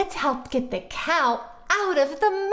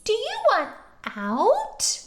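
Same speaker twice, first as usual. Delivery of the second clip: much higher coaxing question; 7 semitones higher than usual. Acted. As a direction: coax the cow gently. Asking the cow sweetly.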